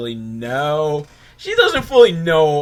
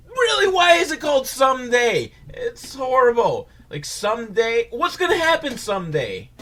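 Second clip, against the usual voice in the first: exasperated shout; 11 semitones higher than usual. Real, not acted. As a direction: yell it in disbelief at the silly name. Outraged at the name Someday.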